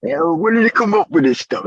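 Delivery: high-pitched, hammed-up Brooklyn accent; badly faked Brooklyn tough-guy line; horrible Brooklyn accent. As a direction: put on a bad Brooklyn accent. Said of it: "That's my horrible Brooklyn accent".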